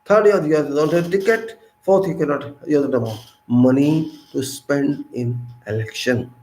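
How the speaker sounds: ordinary talk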